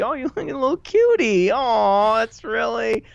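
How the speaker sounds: high, loud mock gushing; playful cooing. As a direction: coo as if at something adorable. Gushing over the cute name.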